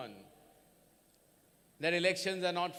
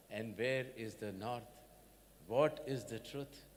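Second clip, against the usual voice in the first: usual speaking voice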